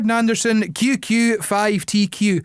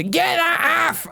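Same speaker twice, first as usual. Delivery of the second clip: mum telling off; 10 semitones higher, much slower than usual. Retold or imitated. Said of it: "my mum would go".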